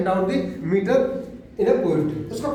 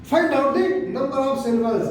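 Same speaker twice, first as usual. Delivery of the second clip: slow dictation; much slower than usual. Shown as a demonstration. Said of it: "teacher repeats step slowly".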